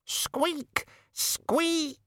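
very high tiny squeak; rat squeak. Acted. Death of Rats squeaks.

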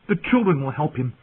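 high-pitched eager outburst; blurted. A narrator's reading. Bran remembering how the story ended, blurted. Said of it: "he blurted".

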